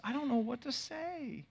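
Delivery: a hint of a shy little boy; light, high child voice; small boy. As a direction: son unsure how to pray. Four-year-old son asked to pray.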